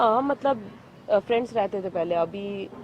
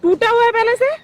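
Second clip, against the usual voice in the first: loud, high incredulous question; outraged disbelief; 10 semitones higher, 7 dB louder than usual. Real, not acted. Woman disputes that glasses were already broken, outraged. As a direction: fling his claim back, voice rising at the end.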